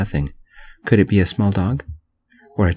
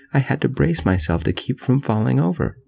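usual speaking voice

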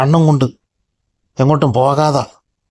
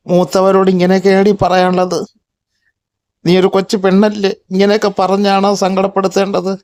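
higher, reproachful elder cat voice; scolding older cat; 6 semitones higher than usual. Acted. Older cat scolding the young girl cat, higher reproach. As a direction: tell the young one off, pitched up.

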